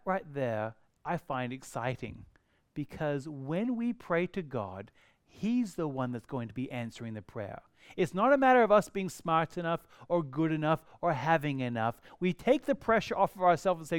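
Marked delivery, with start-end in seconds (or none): none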